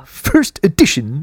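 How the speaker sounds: high, slow nerdy drawl; Comic Book Guy. Pretend. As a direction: drawl it like a snooty nerd. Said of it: "That's the comic book guy".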